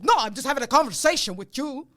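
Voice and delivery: loud, forceful owner impression; imitated yelling boss. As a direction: insist loudly, as if yelling in conversation. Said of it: "It feels like he's yelling at you".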